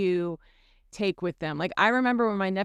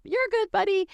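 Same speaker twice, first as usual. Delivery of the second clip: cheerful reassurance; 11 semitones higher than usual. Retold or imitated. Parent reassuring a fallen toddler.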